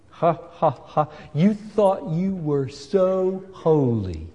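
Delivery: a hint of a gloating devil; slow mock laugh into a sneering jab; devil's mocking taunt. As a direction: taunt them lightly as the devil. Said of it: "That's the way the devil talks".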